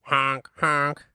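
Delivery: squeaky honking goose voice; silly clown. Acted. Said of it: "the fouls are like".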